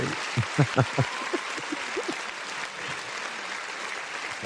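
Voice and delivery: high, trailing fit of giggles; long wheezing laugh. Real, not acted. Host still cracking up at Sherry's buzz, wheezing laughter. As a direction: laugh until only wheeze is left.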